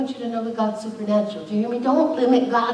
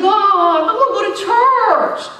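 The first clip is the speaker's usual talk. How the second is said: pleading prayer; 11 semitones higher, 8 dB louder, slower than usual. Retold or imitated. Retold lonely prayer to God.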